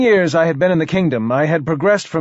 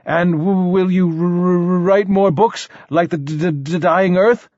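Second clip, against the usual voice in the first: higher voice with repeated snags; stuttering king; 4 semitones higher than usual. Acted. King asks poet for more books, stuttering. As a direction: stammer through a hopeful question.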